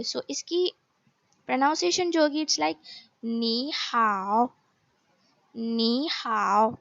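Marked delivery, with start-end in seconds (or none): 3.2-4.5 नी हाव slowly, नी rising and हाव dipping; slow model नी हाव with changed first tone; tone change demonstration. Shown as a demonstration. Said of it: "second tone में convert". 5.5-6.8 नी हाव slowly, नी rising and हाव dipping; slow model नी हाव with changed first tone; tone change demonstration. Shown as a demonstration. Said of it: "second tone में convert".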